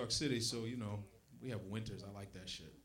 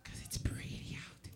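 whispering; 11 dB quieter than usual. Retold or imitated. Imitating woman, whispered.

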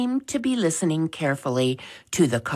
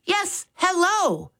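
much higher, slower and careful Yes, hello; slow down to be understood; slower reply. Acted. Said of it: "he responded a bit more slowly".

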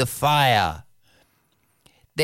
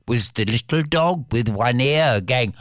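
plain reading voice

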